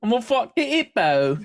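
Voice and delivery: a cheery Australian bloke greeting his mates; hammed-up Australian accent, pitched high; mock Australian accent. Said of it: "The hippo lady is just like".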